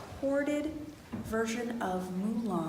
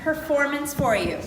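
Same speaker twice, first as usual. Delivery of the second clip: high, loud welcome; projected, high-pitched greeting; calling to an audience; 5 semitones higher, 9 dB louder than usual. Real, not acted. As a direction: address the whole crowd brightly and loudly.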